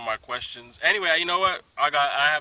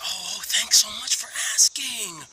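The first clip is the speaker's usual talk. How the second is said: higher, louder, over-the-top thankful voice; gushing gratitude; 9 semitones higher, 9 dB louder than usual. Pretend. Showing how some people answer the question, gushing and high. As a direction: gush your thanks, way too much. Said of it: "some people can say".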